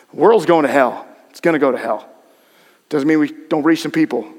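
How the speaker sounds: ordinary talk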